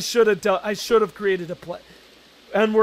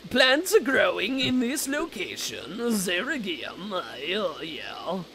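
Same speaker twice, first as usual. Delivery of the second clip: put-on slow Tusk-style accent, fading softer; 3 semitones lower, 4 dB quieter, slower than usual. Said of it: "that accent was".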